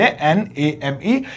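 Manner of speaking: N A M E spelled letter by letter, slow and soft; spelling out. Shown as a demonstration. Teacher spelling the word name for students, letter by letter. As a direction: spell एन ए एम ई one letter at a time. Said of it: "इसे नेम कर देता हूं".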